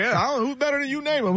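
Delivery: quick, high-pitched mock dare; cocky challenge. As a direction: toss the challenge back fast and high. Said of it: "And he's like".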